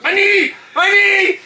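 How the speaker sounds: high, loud cry of alarm; hammed-up injury shout; mock pained yell. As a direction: yelp it out as if hurt, playing it up. Acting out crying out in pain.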